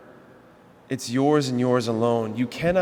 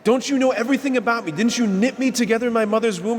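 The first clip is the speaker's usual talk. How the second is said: indignant disbelief; 9 semitones higher, 4 dB louder, faster than usual. Retold or imitated. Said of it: "we would think".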